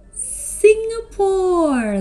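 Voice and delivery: high, slow, drawn-out announcement; excited reveal. Acted. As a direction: unveil it like a big surprise. Big reveal of place.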